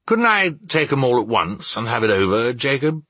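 quick, hopeful suggestion; hint hurriedly, lifting the name at the end; tentative hinting. A narrator's reading. Scrooge asks to take all spirits at once, tentative hint. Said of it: "hinted Scrooge".

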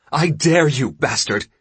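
high, loud furious wife; defiant, angry shriek, acted; shrieked dare. A narrator's reading. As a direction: shriek a challenge at a husband. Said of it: "she shrieked at her husband".

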